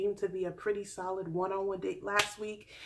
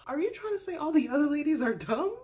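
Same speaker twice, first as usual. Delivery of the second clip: sassy retort; 7 semitones higher, 3 dB louder, faster than usual. Retold or imitated. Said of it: "And I was just like".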